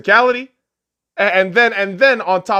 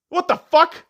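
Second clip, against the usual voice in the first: usual speaking voice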